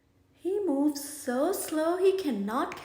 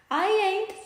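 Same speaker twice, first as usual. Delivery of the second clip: snooty refusal; 7 semitones higher, 5 dB louder than usual. Acted. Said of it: "snouts Monsieur Otter".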